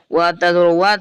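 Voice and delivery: normal talking voice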